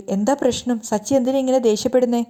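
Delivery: usual speaking voice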